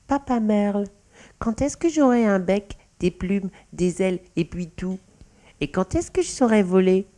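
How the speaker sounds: put-on high-pitched childlike questioning; little mouse child. A narrator's reading. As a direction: ask Papa eagerly in a small high voice. Young mouse questions her adoptive father. (petite voix d'enfant souris)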